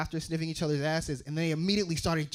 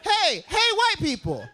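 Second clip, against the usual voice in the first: much higher, louder call; 13 semitones higher, 4 dB louder than usual. Real, not acted. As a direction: hail the crowd loudly.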